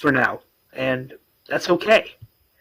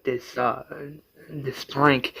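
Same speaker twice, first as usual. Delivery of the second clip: ordinary talk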